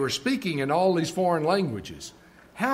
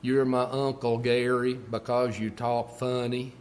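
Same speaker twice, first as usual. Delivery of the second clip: imitating nieces' Texas drawl, pitched lower; Texas accent; 4 semitones lower than usual. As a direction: put on a lower-voiced Texas twang. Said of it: "that's the way they pronounce it in Texas".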